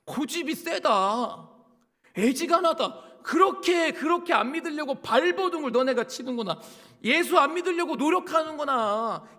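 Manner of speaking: loud, high, drawn-out rebuke; Jesus. Acted. Jesus scolding those who resist belief, loud rebuke. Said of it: "이 모습을 예수님이 보고".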